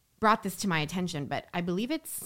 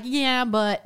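retold doubtful yeah-but; 6 semitones higher, 8 dB louder than usual. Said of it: "I was a little bit like".

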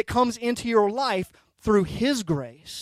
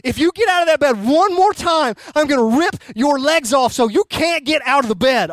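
hammed-up parental rant, loud and high; mock-furious threat to a child; screaming dad; 4 semitones higher, 5 dB louder than usual. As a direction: retell a bedtime blow-up at full steam. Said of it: "Instead of screaming, you're gonna rip".

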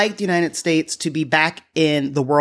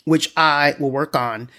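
ordinary talk

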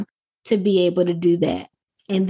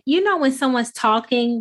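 normal talking voice

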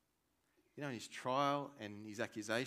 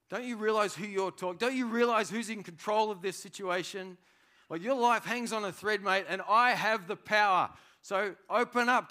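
louder, pressing demand as Pilate; threatening governor; 8 semitones higher, 9 dB louder than usual. Retold or imitated. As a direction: lean on him like you hold his life. Voicing Pilate threatening Jesus.

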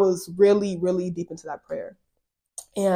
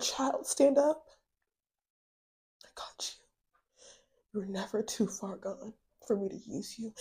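tender hush; 9 dB quieter than usual. Real, not acted. Quoting God tenderly in hush.

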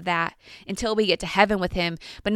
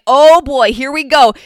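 loud burst of excited recognition; excited exclamation; 5 semitones higher, 12 dB louder than usual. Retold or imitated. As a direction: blurt it out as Peter realizes. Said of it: "he is like".

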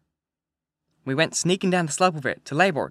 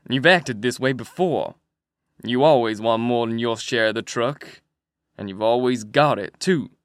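lower, hard accusing voice, slowed; a rough man threatening his partner; pretty loud; 4 semitones lower, slower than usual. A narrator's reading. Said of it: "Another voice said, pretty loud".

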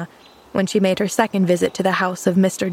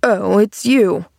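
ungracious; 4 dB louder, slower than usual. A narrator's reading. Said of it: "not very graciously".

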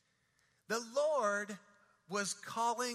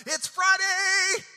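loud, high and slow preacher's yell; 10 semitones higher, 12 dB louder, slower than usual. Retold or imitated. Old pastor ending his sermon, high slow yell. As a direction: yell it out to the whole church. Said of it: "finished his sermon by yelling out".

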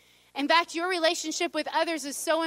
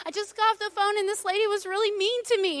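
higher-pitched mild venting, retold; frustrated; 7 semitones higher than usual. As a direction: retell a frustrated complaint in a raised pitch. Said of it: "I'm frustrated".

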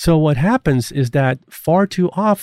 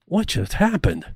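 softer, higher bewildered question; mildly acted bewilderment, hushed and higher; dazed, confused employee; 4 semitones higher, 5 dB quieter than usual. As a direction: voice a stunned employee under their breath. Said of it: "leaving the employee dazed and confused".

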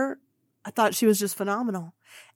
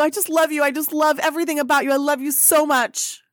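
higher, louder, rushing adoration; gushing fan; 6 semitones higher, 3 dB louder, faster than usual. Retold or imitated. Fan gushing love at Kim, higher and rushed. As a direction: spill it out too eagerly. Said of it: "I go".

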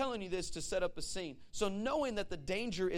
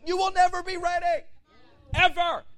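preaching shout; 10 semitones higher, 11 dB louder than usual. Real, not acted. Preacher's climactic declaration.

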